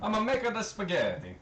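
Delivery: hammed-up Italian accent, pitched well up; bad Italian accent, high and proud; mock Italian accent. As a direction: play a cartoon Italian chef in an Italian accent. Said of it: "my sincerest apologies for my horrible accent".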